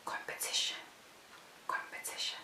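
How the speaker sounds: hushed, voiceless whisper; Holy Spirit's whisper. Retold or imitated. Holy Spirit prompting the interviewee with an answer, whispering. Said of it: "I hear the Holy Spirit say".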